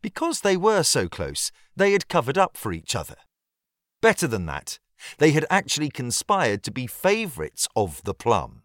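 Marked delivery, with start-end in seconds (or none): none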